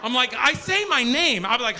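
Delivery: usual speaking voice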